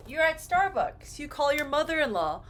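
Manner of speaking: usual speaking voice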